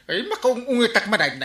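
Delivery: high, loud angry cry; shrill exasperated shout; angry outburst. Acted. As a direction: lash out in anger. Heated barangay quarrel.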